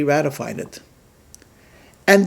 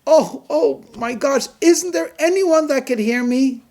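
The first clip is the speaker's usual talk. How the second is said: high, desperate cry for help; frantic plea; 10 semitones higher than usual. Acted. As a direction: beg frantically to be heard. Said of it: "turns into being a frantic plea".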